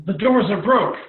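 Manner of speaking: loud cry of alarm; alarmed shout. Acted. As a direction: shout the warning as doors give way. Alarm as doors burst open.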